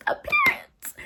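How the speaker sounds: THE PARENTS in a very high squeak; tiny high-pitched "The parents", for dramatic effect; squeaky dramatic repeat. Shown as a demonstration.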